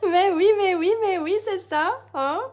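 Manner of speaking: high giggly repeats, tailing off; laughing agreement. Real, not acted. Crafter bantering with live viewers, giggling.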